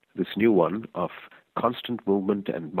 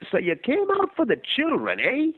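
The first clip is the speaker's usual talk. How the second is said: put-on much higher, gruff old stranger calling out; old man's voice. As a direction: demand his attention as the old vagrant.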